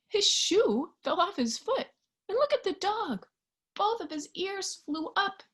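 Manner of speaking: plain reading voice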